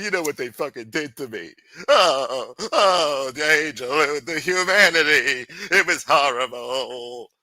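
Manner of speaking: mock grief over their suffering; mock lament. Streamer mocking rivals' victim act, hammed-up grief. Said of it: "mocking rivals' victimhood".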